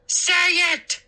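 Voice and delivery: high, pushy demand; insisting. A narrator's reading. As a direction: press him to give in. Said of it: "Moose insisted".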